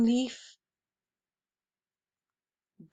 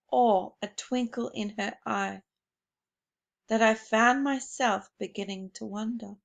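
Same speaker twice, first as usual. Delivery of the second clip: plain reading voice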